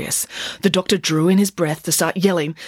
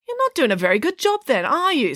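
taunting; 11 semitones higher than usual. Acted. Nanny taunts doctor.

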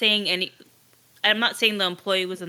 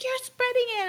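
mock kid scream. Imitating the lady at the drive-thru, mock kid scream. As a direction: imitate a hysterical complaint in a high voice. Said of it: "screaming like a little kid".

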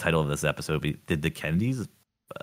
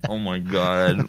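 laughing; much slower than usual. Real, not acted. Laughing disbelief at the prank.

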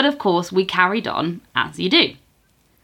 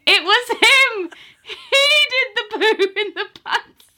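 gleeful accusing shout. Retold or imitated. Mates grassing up their friend in a laughing high shout. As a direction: point him out to the whole pub, gloating.

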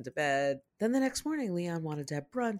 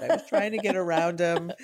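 put-on higher, louder child voice with a laugh; 5 semitones higher, 4 dB louder than usual. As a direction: play an excited little boy. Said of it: "He just said".

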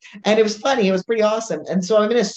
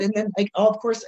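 normal talking voice

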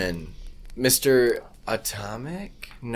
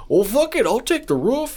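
much higher put-on voice, resigned; mock Russian worker; 12 semitones higher than usual. Host voicing a Soviet worker choosing the roof, high mock voice. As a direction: shrug it off like a doomed worker. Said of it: "or you wanna go and shovel a roof".

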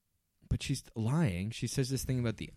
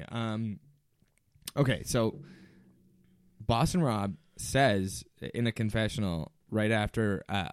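normal talking voice

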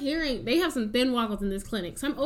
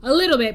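louder emphatic repeat of a little bit; 9 dB louder, faster than usual. Shown as a demonstration. Host stressing the change of format, louder.

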